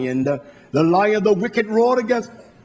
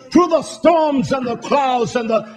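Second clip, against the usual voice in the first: put-on high, loud old-time church cry; old folks' preaching; 7 semitones higher, 4 dB louder than usual. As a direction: build to a full-voiced old folks' testimony shout. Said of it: "as the old folks would say".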